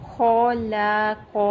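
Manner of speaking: خلق said the wrong way, stretched; deliberately wrong recitation. Shown as a demonstration. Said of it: "اس کو نہیں کرنا، یہ رانگ ہو جائے گا".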